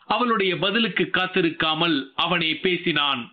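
plain reading voice

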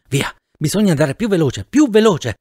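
urgent cry, rising to a high peak; urgent urging. Acted. Fleeing man urging speed while under fire, urgently. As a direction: push harder on the repeat, pitch leaping up.

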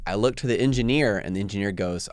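usual speaking voice

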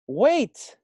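high, loud bossy cry; bossy frog. Acted. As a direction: stop her with a bossy shout. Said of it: "Frog croaked in his bossiest voice".

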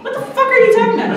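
put-on recoiling at boyfriend's joke; laughing indignant what; shocked outburst. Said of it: "And I was like".

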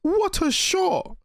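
very high, thrilled disbelief; amazed exclamation. Real, not acted. Viewer amazed at what a shot it was, thrilled. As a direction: marvel in a high, stretched voice.

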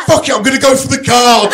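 high, excited mock game-show cry; decide it over a roaring crowd; fired-up contestant.

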